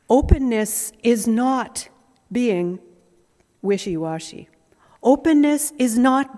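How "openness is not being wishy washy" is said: usual speaking voice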